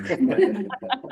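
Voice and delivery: bright high-pitched laugh; a quick amused laugh that rises. Real, not acted. Laughs after thanking Betty.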